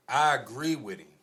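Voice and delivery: ordinary talk, nothing marked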